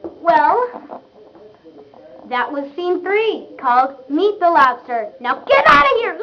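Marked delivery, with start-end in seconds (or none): 5.2-6.2 yelling. Acted. Shooing puppet offstage.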